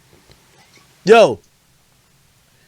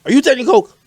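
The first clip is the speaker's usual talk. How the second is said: surprised question; 10 semitones higher, 4 dB louder than usual. Real, not acted.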